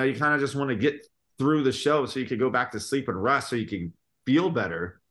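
normal talking voice

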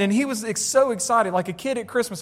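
usual speaking voice

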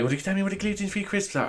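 put-on soft, high affected posh accent; mocking fake-accent request; customer's fake accent. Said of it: "the woman was like".

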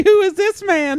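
put-on very high, loud little-kid voice; scared child. Kids clinging to their new daddy's legs, mock-scared squeal. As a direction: squeal it like an alarmed small child. Voicing boss's frightened children.

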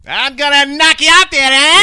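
put-on teasing Irish accent bit; mock Irish accent. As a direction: ham up an Irish brogue, playfully threatening. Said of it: "That was actually Irish".

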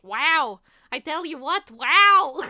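loud, drawn-out happy wows; cartoon bat, delightedly smitten; Bartok. Bat just kissed by the lady bat, happily wowed. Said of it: "Just so happy".

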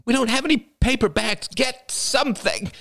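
very high, frantic yell; panicked cry. A narrator's reading. Couple hunting for a paper bag mid-attack, panicked.

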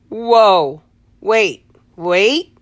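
loud, high stunned gasp of words; shocked whoa. Retold or imitated. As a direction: blurt it out in sudden shock. Hearing shocking diagnosis retold.

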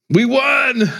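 mock triumphant shout from a limping man. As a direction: crow it out like a beaten winner. [name] limping away, claiming victory.

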